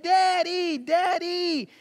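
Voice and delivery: a light imitation of a small daughter calling Daddy; little girl calling.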